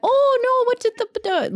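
high, fretful Norwegian accent impression; imitating timid Norwegian accent. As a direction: squeak it out like the scared little Norwegian guy. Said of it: "he has a little accent".

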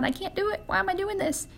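high-pitched mock whine; complaint to God. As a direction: act out a helpless protest. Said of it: "and then ask God".

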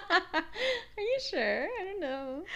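high, giggling, coy; laughing fishing for compliments. Pretend. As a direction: laugh through a needy question. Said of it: "fishing for compliments".

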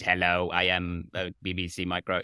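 a light imitation of an early speech synthesizer; mock robotic computer reading its greeting; lightly voiced 1980s home computer; old computer voice. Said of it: "B B C Micros back in, like, nineteen eighties".

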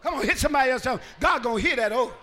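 loud, driving sermon shout; rousing Pentecostal exhortation; full-force preaching. Real, not acted.

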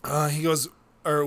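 usual speaking voice

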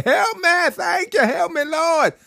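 high, desperate plea to the Lord; crying out for help. Acted. Peter sinking in the waves, crying to Jesus in a high desperate plea. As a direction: beg like you're sinking. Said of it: "He cried".

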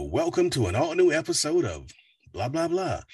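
lightly voiced show announcer. Shown as a demonstration. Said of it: "announcer voices".

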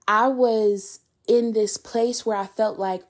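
ordinary talk, nothing marked

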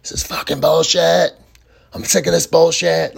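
retold furious yell, hammed up; imitated screaming. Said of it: "He starts screaming at the top of his lungs".

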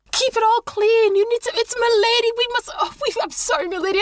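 frantic herald, then fumbling apologies; flustered monk. Acted. Monk shouts lady arrived, flustered.

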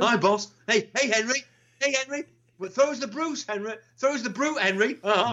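high, chirpy Geordie-ish footballer voice, lightly done; Kevin Keegan impression. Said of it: "he used to do Kevin Keegan".

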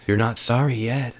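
plain reading voice

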